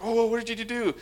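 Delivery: high-pitched imitation of a shocked parent; put-on aghast reaction to a kid's mistake; mock dismay. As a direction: squeal it up high in mock horror. Imitates reaction to wrong clothes.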